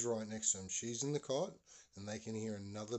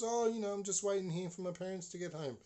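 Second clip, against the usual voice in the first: put-on higher, louder voice for the boy; boy answering neighbour; 8 semitones higher, 3 dB louder than usual. Boy tells neighbour why he waits, high casual voice. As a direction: imitate a boy explaining himself, pitched up.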